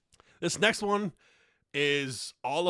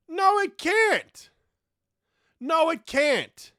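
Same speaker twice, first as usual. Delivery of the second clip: high, loud, slow, insistent; emphatic shout; 11 semitones higher, 6 dB louder, much slower than usual. Real, not acted. Ranting at Oxford Dictionary, loud insistent denial. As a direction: hammer the denial home. Ranting about literally's redefinition.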